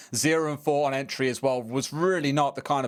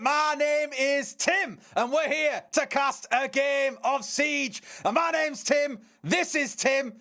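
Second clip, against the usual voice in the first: a put-on, much higher Mancunian accent, slower at first; 11 semitones higher, slower than usual. Caster greeting his co-caster in a mock Mancunian accent. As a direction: open the broadcast hamming up a Mancunian accent. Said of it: "I can't do the accent anymore".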